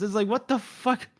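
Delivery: ordinary delivery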